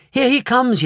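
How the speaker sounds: high excited call; yelled. A narrator's reading. Wonka yells excitedly at the screen. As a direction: announce him eagerly. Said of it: "yelled Mister Wonka".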